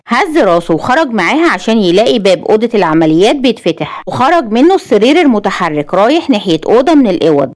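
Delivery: ordinary delivery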